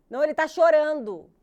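high, very loud nagging mother; imitated insistent alarm. Grandmother insists baby is crying, loud and high. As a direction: insist loudly, overriding the excuse.

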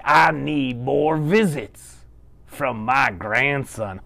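slow pleading. Acted. Grandma asks for visits.

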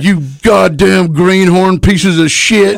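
high, slow, spat-out yell; furious insult. Retold or imitated. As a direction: lay into the rookies with a high yell. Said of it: "He's like".

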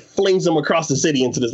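ordinary talk

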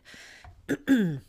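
clearing a tired, failing throat. Real, not acted. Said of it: "Sorry, losing my voice for some reason".

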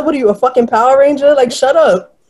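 quick, high and scornful; exasperated mockery. Mocking the girlfriend's grunting, a scornful high retort. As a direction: brush her off with a sneer.

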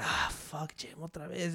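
very quiet, breath-only grumble; low-volume mock dread; weary reluctance. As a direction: let it sigh out under your breath. Acts out dreading the gym.

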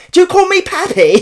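loud, high, hammed-up South London squawk; mock your own South London accent at full volume; incredulous yelp in broad South London; shitty South London accent. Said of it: "Fucking shitty South London accent".

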